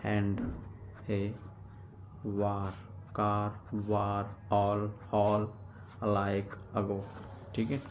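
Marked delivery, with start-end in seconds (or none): 3.2-5.5 slow, careful "कार, वार", vowel stretched; slow word demo. Shown as a demonstration. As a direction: pronounce "कार, वार" slowly as a model. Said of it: "एक मात्रा है तो क्ले".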